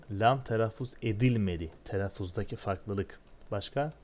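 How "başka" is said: ordinary talk, nothing marked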